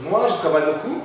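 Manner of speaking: ordinary delivery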